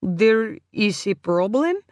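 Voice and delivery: put-on slow, halting Polish accent; unsure worker, thick Polish accent; heavy Polish accent. A narrator's reading. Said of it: "in a heavy Polish accent".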